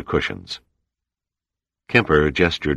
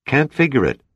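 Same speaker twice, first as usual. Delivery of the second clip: high, raised shout over wind; yelling over storm; 9 semitones higher than usual. Acted. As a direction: yell it above the gale. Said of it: "Kelly yelled back above the storm".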